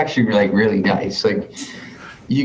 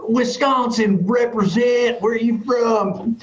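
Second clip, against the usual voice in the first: hyped dude imitation; 9 semitones higher than usual. Retold drunk stoked dudes in Vegas.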